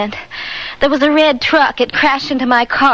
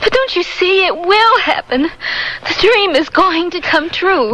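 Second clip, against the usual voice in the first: high, pleading and scared; begging him to believe the danger; frightened insistence; 7 semitones higher than usual. Acted. Frightened wife insists on dream.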